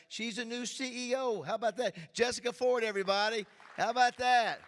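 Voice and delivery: loud, high cheer for the crowd; rally the room behind her; excited hype. Real, not acted. Host hyping girlfriend's new job.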